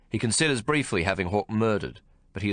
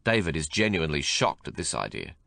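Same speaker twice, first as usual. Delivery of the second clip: ordinary delivery, nothing marked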